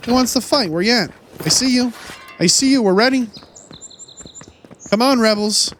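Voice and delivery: playful battle taunt; taunting call. Pretend. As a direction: call out the enemy, spoiling for a fight. Taunting enemies in game.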